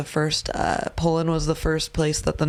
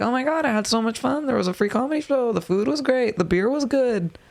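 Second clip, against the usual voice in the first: excited coworker; 5 semitones higher than usual. Retold or imitated. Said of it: "they're gonna be like".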